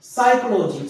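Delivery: साइकोलॉजी said sai-ko-lo-ji, no p at the start; teacher showing the silent p. Shown as a demonstration. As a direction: start साइकोलॉजी straight on the s sound. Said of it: "केवल साइकोलॉजी बोलेंगे".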